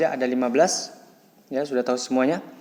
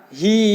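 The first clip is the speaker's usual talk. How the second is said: "Hi" held long and higher; one-beat lengthening; 6 semitones higher, 4 dB louder than usual. Shown as a demonstration. Teacher isolates the long syllable, held vowel. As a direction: stretch the i in "Hi" for one swing. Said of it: "dibaca panjang satu ayunan".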